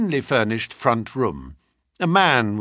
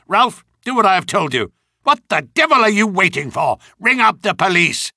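loud, fast, impatient command; impatient angry orders; 6 semitones higher, 5 dB louder, faster than usual. Acted. Colonel impatient, shouting orders.

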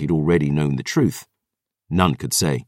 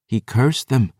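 ordinary delivery

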